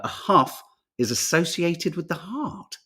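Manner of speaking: usual speaking voice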